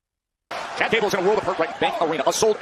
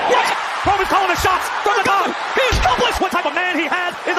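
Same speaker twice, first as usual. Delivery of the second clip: loud, much higher shouting call; play-by-play shout; 11 semitones higher, 4 dB louder than usual. Real, not acted. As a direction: call the beatdown at full volume. Commentator calls attack at full force.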